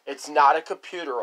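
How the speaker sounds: normal talking voice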